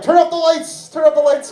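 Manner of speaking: high-pitched comic command; urgent call. Acted. Acted urgent call during lovemaking.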